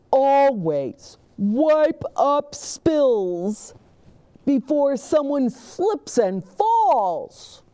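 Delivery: high, slow, loud whisper; earnest safety officer. Acted. Officer Buckle warns the children, slow and stagey. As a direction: give the tip slowly in a stage whisper.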